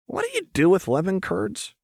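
high-pitched, eager question; oddly intense curiosity; Jinx, curious. A narrator's reading. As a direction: ask in a high, rising chirp.

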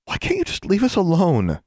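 high-pitched, upset plea; mildly distressed girl's plea; trembling a little. A narrator's reading. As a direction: plead with a slight tremble. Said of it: "trembling a little".

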